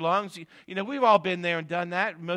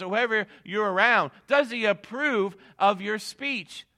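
loud, higher, slowed preaching on the key questions; full-force preaching; 6 semitones higher, 7 dB louder than usual. Acted. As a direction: drive it home to the congregation.

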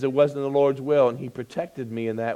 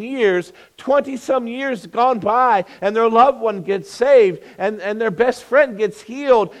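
loud, fervent preacher; preaching; 8 semitones higher, 6 dB louder than usual. Acted. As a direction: preach it from the pulpit. Preaching at full force.

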